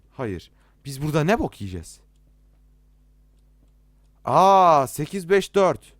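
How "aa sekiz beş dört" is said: loud, drawn-out realization; high, loud eureka cry; excited discovery. Real, not acted. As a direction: call out the find, stretching it slowly. Suddenly finds the code.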